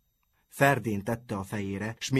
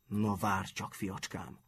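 quiet, menacing warning; soft menace; threatening; 7 dB quieter, slower than usual. A narrator's reading. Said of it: "mondta fenyegetően".

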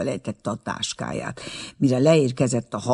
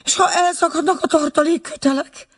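much higher, panicky question; frightened question; 4 dB louder than usual. A narrator's reading. Aunt asking the guide in terror, high panicky voice. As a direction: shriek out the fear of the rope snapping.

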